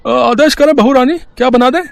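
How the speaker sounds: put-on bow and scrape to the young mistress; servile cook. Cook eagerly asking the new bride what to make.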